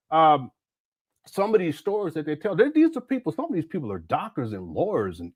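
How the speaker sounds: ordinary delivery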